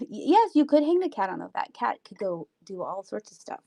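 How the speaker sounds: normal talking voice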